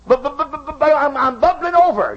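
the heart bursting to rejoice; high sputtering buts that spill over; bubbling-over heart. Acted. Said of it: "But the heart says".

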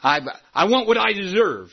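hammed-up entitled grumble; mock whiny demand. Pastor mocking a congregant who wants his say, petulant. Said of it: "You say".

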